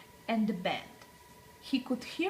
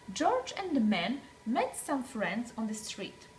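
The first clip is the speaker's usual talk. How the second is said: usual speaking voice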